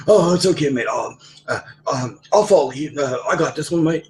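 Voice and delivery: put-on high-pitched English accent; Colt. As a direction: imitate a flustered, eager-to-please English accent. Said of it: "So he acquired an English accent".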